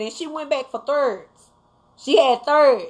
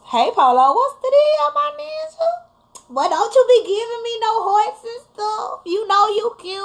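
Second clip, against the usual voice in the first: much higher, playful flirt at a viewer; flirty teasing voice; 11 semitones higher than usual. Pretend. Greeting Polo in the live chat, high flirty tease. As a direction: coo at Polo like you're sweet on him.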